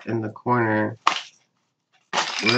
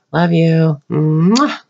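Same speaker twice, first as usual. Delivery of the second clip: higher, louder love you with a kiss; affectionate higher love you and mwah; blown kiss; 5 semitones higher, 5 dB louder, much slower than usual. Real, not acted. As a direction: send a kiss to the camera. Blowing a kiss to friend.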